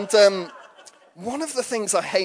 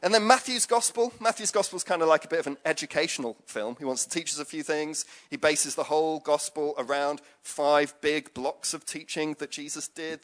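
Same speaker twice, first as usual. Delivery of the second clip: ordinary talk, nothing marked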